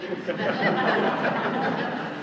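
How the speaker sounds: short airy chuckle; breathy laugh. Real, not acted. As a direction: laugh softly at the absurd version. Laughs at his own joke.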